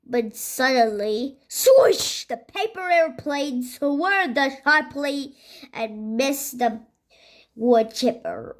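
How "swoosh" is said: high, loud swooshing sound word; exclaimed swoosh. A narrator's reading. Narrator as the plane suddenly swerves, exclaimed swoosh. Story sound effect called out.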